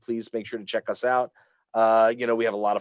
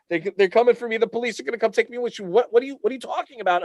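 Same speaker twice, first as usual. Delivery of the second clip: put-on high, fast, frightened child pleading; panicked kid; 11 semitones higher, faster than usual. Boy telling mom the police are coming, high and panicked. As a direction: blurt it out like a scared kid. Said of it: "I said".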